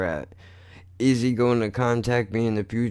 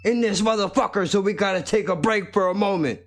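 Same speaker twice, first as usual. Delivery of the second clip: much higher cocky rapper persona; mumble rapper voice; 13 semitones higher than usual. Acted. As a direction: play an obnoxious mumble rapper. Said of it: "So I'm portraying a mumble rapper".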